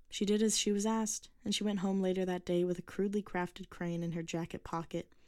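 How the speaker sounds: usual speaking voice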